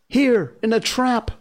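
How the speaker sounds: put-on a trapped child calling out; crying man cub. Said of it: "cried Mowgli".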